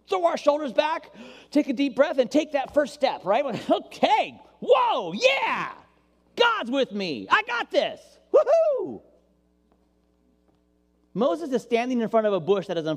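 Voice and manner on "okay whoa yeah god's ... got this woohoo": much higher and louder, rising to a whoop; pumped-up cheer. Acted. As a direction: hype yourself up like you just got courage. Acting pumped-up believer.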